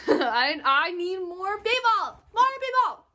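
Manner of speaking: a playful begging yell; comic shout. Real, not acted. Comic plea for more fans.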